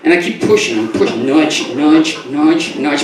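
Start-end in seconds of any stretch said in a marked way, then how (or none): none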